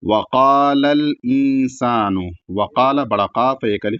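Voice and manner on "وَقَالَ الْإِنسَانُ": slow, drawn-out tajweed recitation; Quran recitation. Shown as a demonstration. Teacher reciting the verse for students to copy. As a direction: chant the verse slowly and evenly. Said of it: "اس طریقے سے پڑھیں گے".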